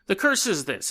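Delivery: usual speaking voice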